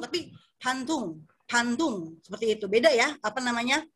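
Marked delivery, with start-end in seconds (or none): 0.6-2.2 Bandung slowed, starting on an airy P, like "Pantung"; Korean-style Bandung. Shown as a demonstration. Teacher imitating Koreans saying the city, Bandung devoiced. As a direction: soften Bandung's B and D towards P and T. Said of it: "Jadi kalau orang Korea ngomong".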